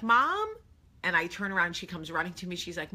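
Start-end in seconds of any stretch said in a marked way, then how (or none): 0.0-0.5 high, loud questioning call; child calling. Retold or imitated. Daughter spots her mom on the field, high child's call. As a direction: imitate a kid calling for a parent.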